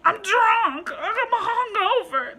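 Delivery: high, loud, slow stoned rabbit voice with a laugh; high, drawled, laughing puppet rabbit; Bobcat's bunny voice. Retold or imitated. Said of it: "That's how he would sound".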